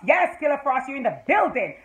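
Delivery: thrilled shout-out; excited cheering. Real, not acted. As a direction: hype up her entrance with punch. Fan cheers character's appearance.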